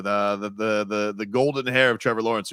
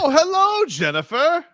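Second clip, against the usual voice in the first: sing-song greeting; 3 dB louder than usual. Pretend. Greeting in a put-on voice.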